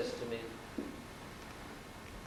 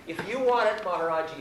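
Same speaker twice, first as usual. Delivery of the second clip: high, louder imitation of the devotee; eager young devotee; 5 semitones higher, 4 dB louder than usual. Young companion offering the car to the guru, high imitated eagerness. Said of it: "this kid looks up and he says".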